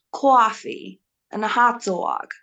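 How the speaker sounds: slow, New Yorkish Boston accent; tentative East Coast American accent, somewhat New York; Boston accent attempt. Shown as a demonstration. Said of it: "a Bostonian accent from the United States".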